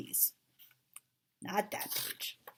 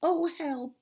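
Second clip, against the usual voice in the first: higher, mildly distressed cry for help; a touch of helpless dismay, pitched up; lightly voiced stuck character; 10 semitones higher, 3 dB louder than usual. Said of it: "he said inside the jar".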